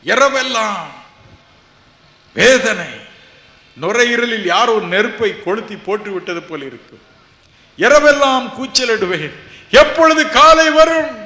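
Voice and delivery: loud, high, agonized wail; anguished cry. Retold or imitated. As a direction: cry out the pain of sleepless nights. Preacher reliving nights of agony.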